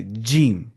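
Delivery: "جیم" drawn out with a clear voiced j; correct jeem. Shown as a demonstration. Teacher correcting a common mistake in jeem, correct pronunciation. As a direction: model the letter "جیم" slowly for learners. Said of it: "چیم نہیں پڑھنا ہے".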